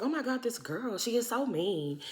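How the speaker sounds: quiet mock complaint to a friend; hushed gossip. Retold private gossip about Candace.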